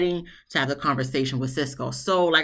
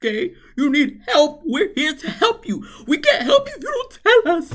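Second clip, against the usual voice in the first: high, wobbly imitation of a tearful plea; hammed-up teary voice, much higher and louder; mock crying Rich; 11 semitones higher, 3 dB louder than usual. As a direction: ham up his teary speech. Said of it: "he started crying and shit".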